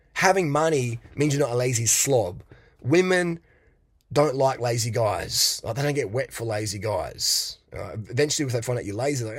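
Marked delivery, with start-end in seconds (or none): none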